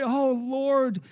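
high, loud, panicked plea to the Lord; fearful cry. Retold or imitated. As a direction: voice a frightened believer crying out. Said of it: "we say".

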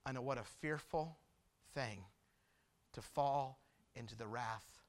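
low, soft, halting gravity; solemn preaching. Real, not acted. Preacher warns congregation of God's wrath, quiet and grave. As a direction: weigh each phrase with long pauses.

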